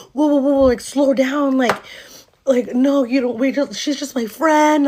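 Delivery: high, loud backpedaling; mock frantic protest. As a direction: imitate a caught boyfriend scrambling to explain. Boyfriend begs her to slow down.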